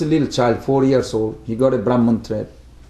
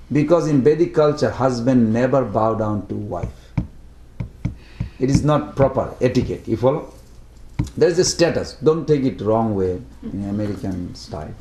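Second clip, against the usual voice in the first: ordinary talk, nothing marked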